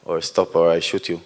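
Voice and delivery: ordinary talk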